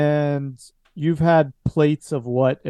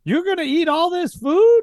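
put-on high, incredulous old woman; Mama Tacconi amazed at Eddie's appetite; shocked; 13 semitones higher, 3 dB louder than usual. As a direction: gasp at how much he ordered.

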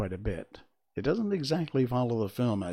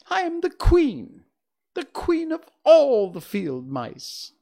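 indignant squeak; 8 dB louder than usual. Acted. Mouse queen offended by the Woodman, loud high indignant squeak. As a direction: bristle with tiny royal offence.

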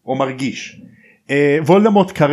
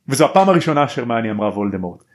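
ordinary talk

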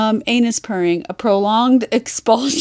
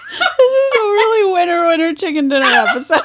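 laughing; 6 semitones higher than usual. Real, not acted. Laughing while talking.

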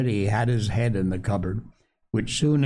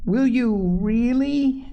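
put-on high, slow, whispery child's question; doubtful little girl; 10 semitones higher, slower than usual. A narrator's reading. Phronsie asks the doctor for assurance, doubtful and high. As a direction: ask in a small high voice, half believing. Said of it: "asked the little girl doubtfully".

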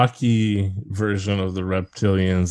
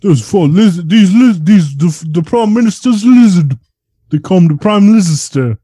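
put-on British accent; 10 semitones higher, 7 dB louder than usual. British bit continues.

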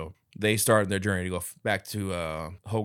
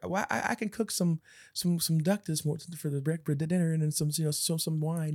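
super nice little man; 8 semitones higher than usual. Retold or imitated. Tiny host offering duck and wine, lightly high. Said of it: "He says some crap".

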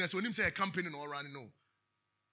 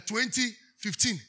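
normal talking voice

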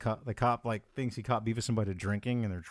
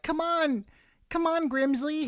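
much higher, coaxing mock voice; coaxing the bear. Imitating Grizzly Man coaxing the bear, high coaxing. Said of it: "you hear him going".

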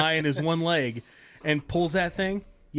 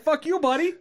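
very high mock heckle with a rising end; comic high-voiced retort; imitated Canadian heckler; 12 semitones higher than usual. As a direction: play the irate passerby, pitched way up. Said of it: "that's what he kept saying".

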